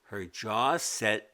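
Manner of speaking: plain reading voice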